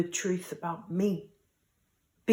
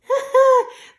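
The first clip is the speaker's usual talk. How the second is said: high loud laugh; short high laugh; burst of laughter; 9 dB louder than usual. Real, not acted. Laughs at own joke.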